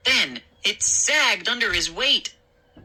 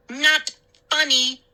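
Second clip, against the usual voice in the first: snapped; 9 semitones higher, 3 dB louder than usual. A narrator's reading. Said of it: "she snapped".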